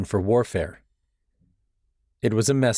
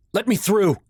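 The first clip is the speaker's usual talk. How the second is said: loud, high angry snap; snapping; 12 semitones higher, 4 dB louder than usual. A narrator's reading. Son forcing past onlookers at the fire, snapping angrily. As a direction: push through the crowd with a sharp bark. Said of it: "I snapped".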